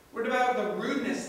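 ordinary delivery

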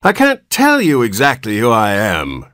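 put-on high, whining old man; querulous whine. A narrator's reading. Newborn old man explains himself to his father, whining. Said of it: "replied the querulous whine".

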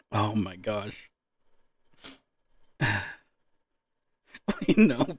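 ordinary talk

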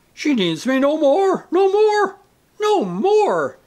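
very high, loud theatrical anguish; choking voice. A narrator's reading. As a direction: choke out a hammed-up lament. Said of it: "he said in a choking voice".

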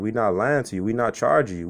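normal talking voice

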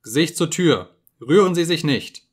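loud, sharp order from a hidden speaker; cold loud command from the telescreen. Acted. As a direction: bark the command down into the cell. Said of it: "sagte die Stimme".

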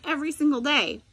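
plain reading voice

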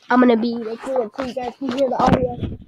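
usual speaking voice

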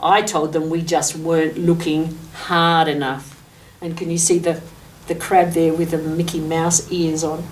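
ordinary delivery, nothing marked

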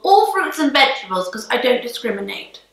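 normal talking voice

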